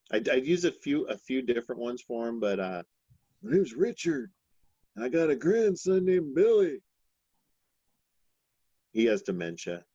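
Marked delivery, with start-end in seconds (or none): 3.4-6.8 put-on high, loud old man voice; rambling grandpa story, high and creaky. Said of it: "your grandpa voice".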